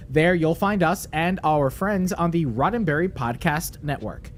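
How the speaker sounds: punchy, projected opening that settles into a read; podcast promo read. Acted. Host retaking the plug after a fluff, energetic promo read. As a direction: launch the plug with extra energy, then ease off.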